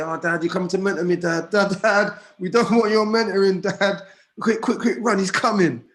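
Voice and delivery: high, loud mocking kids' chant; playful imitation of kids mocking their dad; teasing kids. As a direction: tease the dad like cheeky children. Voicing his teasing children.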